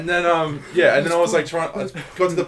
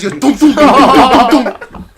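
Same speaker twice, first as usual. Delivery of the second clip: high, very loud "tonk"s through laughter; escalating beak-hammer volley; imitated frenzy of pecks; 11 semitones higher, 15 dB louder, much slower than usual. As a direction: pound out repeated pecking noises. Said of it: "and just goes".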